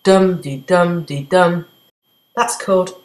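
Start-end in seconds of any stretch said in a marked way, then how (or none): 0.0-1.6 slow, sung-out "dum-de-dum-de-dum" with the beat on each "dum"; rhythm demo. Shown as a demonstration. A teacher chants the trochaic beat for students. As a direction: tap out the trochee. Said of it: "stressed, unstressed".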